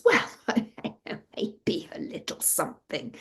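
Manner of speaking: soft, whispery, chuckling admission; tipsy father. A narrator's reading. Drunk father to daughter, relenting softly. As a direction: give in with a woozy little chuckle.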